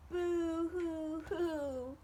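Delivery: slow, high hammed-up group sob; boo-hoo crying. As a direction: draw out "Boo-hoo-hoo" as the sad friends cry together. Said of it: "cried Bird's friends together".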